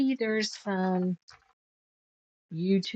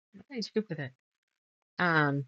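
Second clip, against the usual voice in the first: normal talking voice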